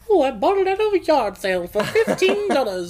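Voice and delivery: high mocking mid-Atlantic accent, laughing. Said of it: "fake-ass, like, mid-Atlantic accent".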